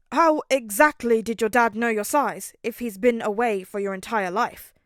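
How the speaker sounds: loud, probing question; suspicious. A narrator's reading. Ida questioning the dad's gift, loud and suspicious. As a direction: press him, doubting the story. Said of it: "his tone suspicious".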